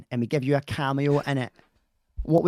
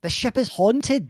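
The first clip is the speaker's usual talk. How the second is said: high, slow correction landing on "haunted"; stress on haunted; 8 semitones higher, 3 dB louder, slower than usual. Shown as a demonstration. Husband correcting his wife's line, slow stress on "haunted".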